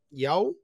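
YAO with a rising tone; tone going up. Shown as a demonstration. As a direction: let yao glide upward. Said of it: "So that goes up".

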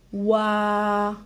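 "Wa" with a long held vowel; drawn-out syllable. Shown as a demonstration. Teacher sounding out oiseau, drawn-out "Wa". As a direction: stretch "Wa" out on its own. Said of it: "Alors, on y va".

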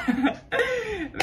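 burst of laughter. Real, not acted. Laughs at dad calling Diego son-in-law.

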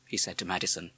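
ordinary delivery

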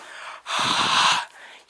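long, loud voiceless exhale; loud breath out. Pretend. Taunting the listener, loud blown breath. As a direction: blow out a big gust of air. Blowing out breath while whispering.

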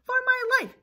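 high, loud alarm cry; hammed-up panic. Chicken Little thinks the sky is falling, panicked cry. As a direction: raise the alarm like a frantic cartoon chick.

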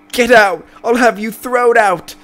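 very high, loud screams for help; panicked yelling. Acted. Mother screaming for staff to remove her son. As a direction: shriek for someone to come.

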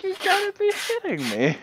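high, squeaky mock disbelief. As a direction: squeal it in fake outrage. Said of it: "I'm like".

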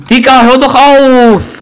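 an angry, slow plea to quit laughing; annoyed yell. Real, not acted. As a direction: tell him to stop. Protesting friend's laughter.

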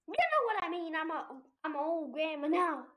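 loud, high-pitched mock outburst; exasperated outburst. Player explaining they're an old grandma now, exasperated outburst. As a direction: burst out loudly in playful protest.

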